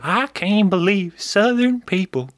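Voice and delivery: mock country woman, drawn out. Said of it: "a high-pitched voice".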